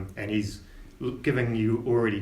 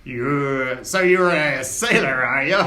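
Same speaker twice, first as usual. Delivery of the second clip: sneering; 7 dB louder, slower than usual. Acted. Scabbers sneering at player.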